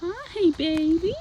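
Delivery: high, slow cooing greeting. Real, not acted. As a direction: coo a hello to a pet. Greeting the horse softly.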